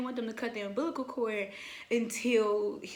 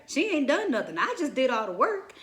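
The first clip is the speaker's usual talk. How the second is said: higher, louder mock indignation; swooping, put-upon protest; retold sassy retort; 4 semitones higher, 5 dB louder than usual. New mother on the tired grandmother, mock-indignant retort.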